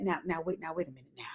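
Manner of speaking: very soft backpedaling under the breath; mock stalling. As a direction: drop way down, as if buying time. Acting Quad stalling when confronted.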